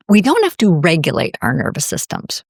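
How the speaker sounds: ordinary delivery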